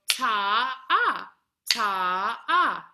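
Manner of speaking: ta stretched into ah; held ta-ah. Shown as a demonstration. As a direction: show the two-beat note. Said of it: "scrape it up to show".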